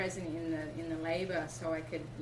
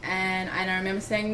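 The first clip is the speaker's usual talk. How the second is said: usual speaking voice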